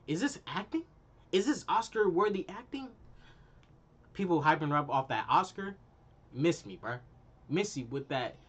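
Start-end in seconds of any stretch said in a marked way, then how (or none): 0.0-2.9 incredulous mockery. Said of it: "I'm like".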